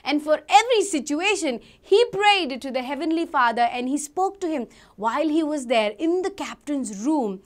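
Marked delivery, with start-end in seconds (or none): none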